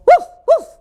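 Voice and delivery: two very high little woofs; dog woof. A narrator's reading. Hungry dog chasing a cat, high woofs. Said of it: "howled Hungry Dog".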